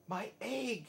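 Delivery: high excited cry; a high, amazed exclamation. A narrator's reading. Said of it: "shouted Horton".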